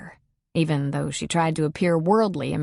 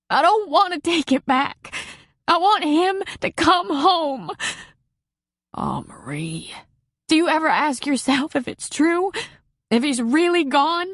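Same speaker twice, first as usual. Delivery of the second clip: sobbing plea; 10 semitones higher than usual. Acted. Marie begging Patty about her dead husband, high sobbing plea. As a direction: beg through the sobs, voice pitched up. Grieving widow sobbing on phone.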